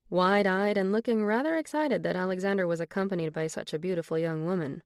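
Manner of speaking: plain reading voice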